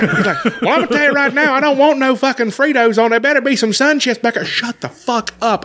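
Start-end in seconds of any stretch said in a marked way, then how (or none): 0.6-4.4 high-pitched hollering customer; mock yell, pitched way up; random yelling. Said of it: "just randomly yelling things". 4.4-5.6 high, slow, drawn-out mock outburst; retold exasperated snap; fed-up outburst. Storyteller snaps at the yelling man.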